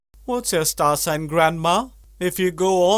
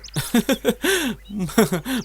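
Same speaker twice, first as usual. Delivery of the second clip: higher, rolling chuckle; amused laugh; 5 semitones higher, 3 dB quieter than usual. Acted. Laughing at friends who finished the medicine, amused chuckle. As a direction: laugh lightly at their blunder.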